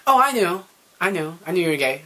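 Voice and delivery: playfully smug, we always knew; knowing friends. Retold or imitated. Friends claiming they knew.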